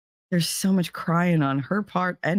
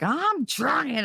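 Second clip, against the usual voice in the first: daughter pleading; 10 semitones higher than usual. Retold or imitated. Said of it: "And she's like".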